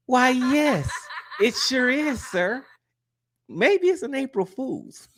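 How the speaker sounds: high-pitched, laughing delivery; amused laughing reply. Real, not acted. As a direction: squeal it out through real laughter. Amused reply to April Fool's question.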